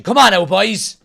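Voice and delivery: loud urging of the players; rallying shout. Real, not acted. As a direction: shout to spur the team on. Urging the team on.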